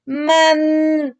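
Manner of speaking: "Man" stretched, with a long held n; slow reading of Man; sounding out syllable. Shown as a demonstration. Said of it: "ahora vamos a leer".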